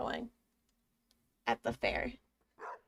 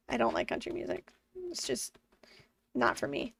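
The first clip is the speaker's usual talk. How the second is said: whisper; 7 dB quieter than usual. Acted. Whispered confession.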